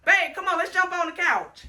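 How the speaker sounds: loud, high, eager call; excited call. Real, not acted. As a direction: call out to jump on it. Calling partner to jump.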